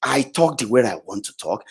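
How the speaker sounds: low, soft, deliberate aside; dropped low voice. Real, not acted. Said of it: "I speak like this".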